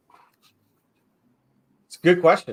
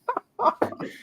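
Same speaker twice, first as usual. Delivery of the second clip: high helpless laugh; sudden high-pitched cackling laugh; burst of laughter. Real, not acted. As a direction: crack up mid-conversation. Why are you laughing.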